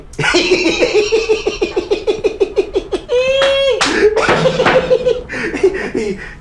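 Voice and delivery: high, long helpless laugh; burst of laughter. Real, not acted. Clerk laughing at the lawyer's name, high helpless laughter.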